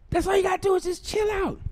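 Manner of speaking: high, fast shout; fed up with the date, shouting fast; high exasperated shout. Retold or imitated. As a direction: blurt it out exasperated. Exasperated outburst.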